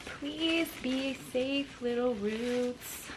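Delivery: soft, slow coaxing to the roots; softly pleading to the roots; tender plea. Real, not acted. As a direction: plead gently with the plant.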